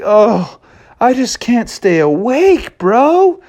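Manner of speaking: high, slow drowsy whine; drowsy high cub; sleepy cub. Acted. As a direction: moan it half asleep. Junior sleepy reply.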